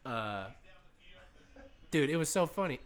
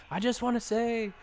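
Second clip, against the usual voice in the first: mimicking McGregor; 7 semitones higher, 6 dB louder than usual. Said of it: "he was saying".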